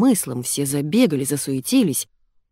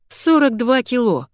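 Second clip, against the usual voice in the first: higher-pitched exclamation; startled, raised-voice exclamation; exclaiming; 6 semitones higher, 4 dB louder than usual. A narrator's reading. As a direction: cry out at a shocking figure. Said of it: "воскликнула Ольга".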